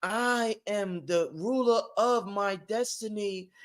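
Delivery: slow, soft mantra voice; mock affirmation. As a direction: drift through it like a meditation. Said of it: "You can't just be".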